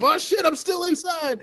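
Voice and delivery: high, laughing surprised yelp; startled realization, laughing; surprised shout. Pretend. Helmet off, surprised.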